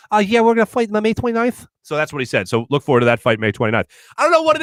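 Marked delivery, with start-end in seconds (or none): 0.1-1.7 Mike Tyson impression. Host corrects himself and imitates Tyson's podcast line, Tyson voice. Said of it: "I'm sorry, he didn't say that. He said".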